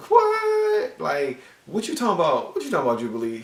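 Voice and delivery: loud, very high-pitched disbelief; incredulous outburst. Acted. Reviewer challenging Jubilee over Psylocke, loudly incredulous.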